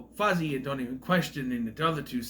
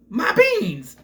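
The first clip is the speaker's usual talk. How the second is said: loud "My beans!" in a put-on voice; mock Jorgen yell; 7 semitones higher, 10 dB louder than usual. Retelling the scene, a loud put-on squeal. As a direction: redo Jorgen's cry. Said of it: "That's how he says that".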